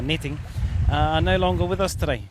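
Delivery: ordinary talk, nothing marked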